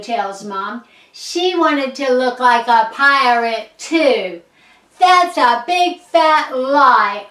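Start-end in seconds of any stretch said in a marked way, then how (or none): 5.0-7.3 loud, slow protest; loud, drawn-out shouted denial; indignant shout. A narrator's reading. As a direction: shout it out, stretching the accusation. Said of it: "I shout".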